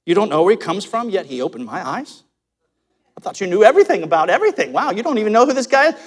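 mock amazement with swooping pitch; dripping sarcasm, quickening into disbelief; sarcastic. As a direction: rub it in with feigned wonder. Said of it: "now he gets sarcastic".

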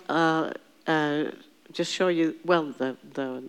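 normal talking voice

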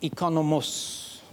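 economos drawn out slowly, syllable by syllable. Shown as a demonstration. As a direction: spell out economos slowly for the crowd. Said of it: "I'll say it slow".

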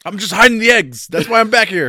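loud, high-pitched protest as the bunny; Easter Bunny excuse. Acted. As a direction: play the caught Easter Bunny pleading loudly. Plays Easter Bunny excusing himself to cops.

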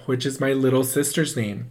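ordinary delivery

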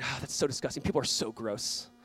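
quiet, low inward disgust; judgmental disgust. Retold or imitated. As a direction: sigh it under your breath, judging others. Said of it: "I'm just judging in my heart, saying".

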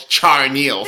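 Charneal drawn out slowly as char-NEEL; deliberate mispronunciation. Shown as a demonstration. Host teasing co-host about her name, Charneal as slow char-NEEL. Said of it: "so I'm gonna call you this".

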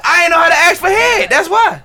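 complaining shout. Real, not acted. Loud confession.

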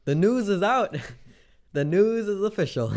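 high, loud mock announcer; excited announcement. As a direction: proclaim it like big news. Announcing the 24-hour stream.

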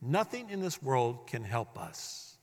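hushed, slowed restatement; soft slow echo. Acted. Preacher repeats key line.

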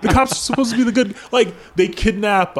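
quick, high words broken by laughter; laughing disbelief. Real, not acted. Still mocking the good-guys idea, fast laughing retort. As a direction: rattle it off still cracking up.

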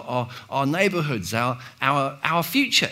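ordinary delivery

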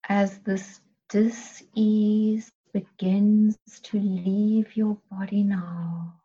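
usual speaking voice

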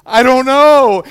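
loud, high, drawn-out reply; mock overwhelmed yell. New dad answering wife, mock overwhelmed yell. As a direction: holler it slowly in comic panic.

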